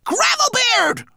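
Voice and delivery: very high, drawn-out cry; startled Twigleg. A narrator's reading. Homunculus recognising the hidden dwarf, startled cry. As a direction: cry out the name in shock. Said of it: "cried the startled Twigleg".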